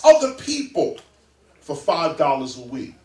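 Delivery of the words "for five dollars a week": ordinary delivery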